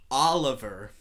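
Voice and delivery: Oliver with a rolled American r; American accent. Retold or imitated. Friend giving his name again at a restaurant in an American accent. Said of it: "until he said".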